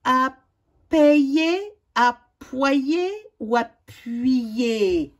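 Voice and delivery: PAYER, APPOYER, APPUYER slowly, endings stretched; sounded-out options. Shown as a demonstration. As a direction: offer PAYER, APPOYER, APPUYER as slow choices. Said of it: "Complète les mots avec".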